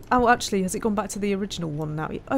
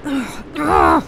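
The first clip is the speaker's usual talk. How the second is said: frustrated groans; 6 semitones higher, 10 dB louder than usual. Real, not acted. Frustrated noise at game.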